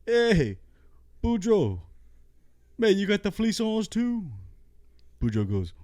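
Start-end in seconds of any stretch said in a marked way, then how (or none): 0.1-4.3 high, squeaky Cajun character voice; high cartoonish Cajun yokel; Thibodeau voice. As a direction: pitch way up for the old Cajun buddy. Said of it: "Thibodeau goes".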